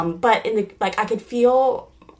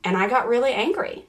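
normal talking voice

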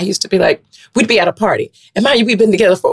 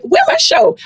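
high, put-out mock demand; mock indignant demand. New stand-up expecting a show, comically indignant. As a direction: ask it high, as if owed.

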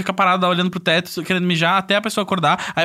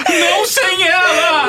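high, loud, very slow sobbing shout; crying shout; 11 semitones higher, 4 dB louder, much slower than usual. Retold or imitated. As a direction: drag it out in hammed-up tears. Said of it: "falou assim".

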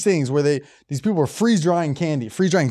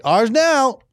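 higher, louder smug voice; mock greedy company; 8 semitones higher, 3 dB louder than usual. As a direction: claim it, playfully smug. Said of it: "they're just like".

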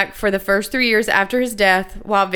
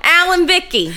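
put-on New Jersey accent; 8 semitones higher, 4 dB louder than usual. Co-host teasing Queen Victoria in a New Jersey accent. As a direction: ham up a Jersey accent, pitched high. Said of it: "your Jersey accent".

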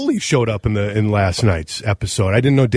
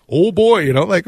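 high mock Minnesota accent; funny Minnesota accent; 7 semitones higher than usual. Shown as a demonstration. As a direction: play up the Minnesota vowels, pitched high. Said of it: "I know we talk funny".

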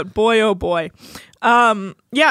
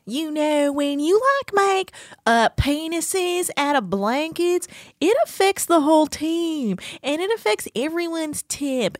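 high, slow mock voice; imitating Amy; 7 semitones higher, slower than usual. Quoting Amy scolding Kate, high mock voice. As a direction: stretch it out high and prissy. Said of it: "she's like".

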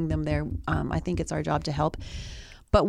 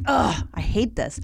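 exasperated; 3 semitones higher, slower than usual. Retold or imitated. Reluctant inner grumble, acted.

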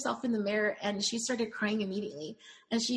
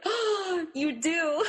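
much higher, louder, teary coo; touched gush; 8 semitones higher, 4 dB louder, much slower than usual. Retold or imitated. Answering the bride who feels beautiful, teary high gush. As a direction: melt with emotion as you reassure her. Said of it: "And I was like".

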